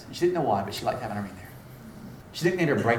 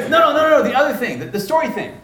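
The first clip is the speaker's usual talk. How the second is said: high, loud eager correction; imitated flustered insistence; 12 semitones higher, 9 dB louder than usual. Student correcting her pastor, imitated as eager and flustered. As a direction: burst in to put him right.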